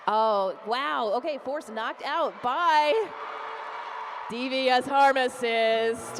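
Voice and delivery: loud, thrilled play-by-play; excited call. Real, not acted. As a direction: shout the big hit with real excitement. Live call of a knockout.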